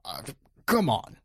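imitated dismissive scoff with a puff; puffed-out, mock-dismissive brush-off. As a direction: scoff it off, mimicking a politician. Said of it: "he scoffs. He goes".

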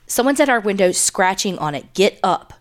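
usual speaking voice

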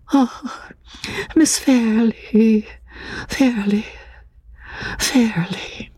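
high woman's voice fading to a whisper; murmuring the name. A narrator's reading. Distressed woman murmuring her friend's name, fading to a whisper.

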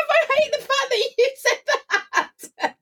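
real giggly embarrassment at the question; laughing with embarrassment. Real, not acted.

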